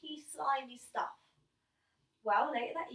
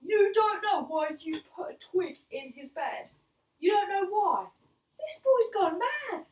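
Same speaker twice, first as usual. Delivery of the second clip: louder, higher ranting father; exasperated dad; 8 semitones higher, 7 dB louder than usual. Retold or imitated. Dad ranting to mum about his son going mad, loud and higher. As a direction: rant like a dad at the end of his rope. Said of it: "My dad just goes".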